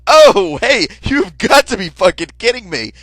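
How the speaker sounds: very loud, high outraged yell. Retold or imitated. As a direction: explode in disbelief. Outraged at request.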